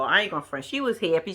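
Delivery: ordinary talk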